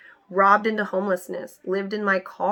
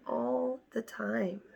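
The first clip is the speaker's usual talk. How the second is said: much softer, slowed, drained "All the time"; quiet, slow repeat; 13 dB quieter, much slower than usual. Shown as a demonstration. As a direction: let it fade out, worn down.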